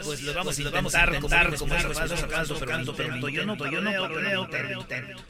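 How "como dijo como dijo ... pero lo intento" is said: repeated phrase fading softer; last word echo. Shown as a demonstration. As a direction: echo effect dying away. (eco que se apaga)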